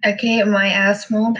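ordinary talk, nothing marked

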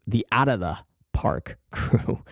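name said again through a laugh; slow repeat with a laugh inside. Real, not acted. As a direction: chuckle mid-phrase, slowly.

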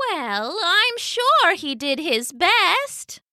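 lightly voiced tender mother; kind Mama. A narrator's reading. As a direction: reassure gently as Mama. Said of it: "said Mama".

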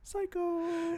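shrieked high Psycho; sudden high squeak; squeaky high voice. Pretend. Names the movie villain.

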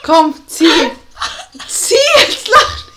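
high loud urging; urgent high shout. Real, not acted. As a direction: push her to go on. Drängt die Freundin. (drängend rufen)

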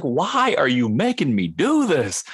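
drawn-out, high-pitched protest; exasperated. Retold or imitated. Voice actor complaining about being made to repeat lines, exasperated.

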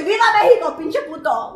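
high, hammed-up defiant cry; going off. Woman retelling going off on a homeless man, defiant yell. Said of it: "Oh, I went off on him".

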